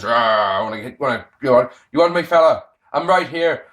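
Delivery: growly-tough Sheamus in bad Irish; mock-serious Irish challenge; awful Irish accent. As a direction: put on a badly faked Irish accent. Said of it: "that's an awful Irish accent".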